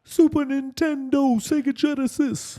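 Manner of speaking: corrected high-pitched rapper voice after clearing the throat. Acted. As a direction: stretch it out in a mock rapper squeal. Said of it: "do in your [name] voice".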